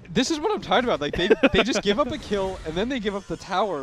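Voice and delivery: loud, high, worked-up rant; hyped-up exasperation. Real, not acted. Caster complaining about a team giving up towers, hyped exasperation. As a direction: get fired up over their mistakes. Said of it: "the most hype I've heard you be".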